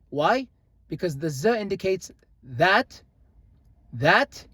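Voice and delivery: ordinary talk, nothing marked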